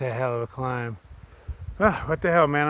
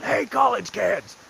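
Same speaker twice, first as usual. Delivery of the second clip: yell out; 5 semitones higher, slower than usual. Retold or imitated. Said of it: "I yell out".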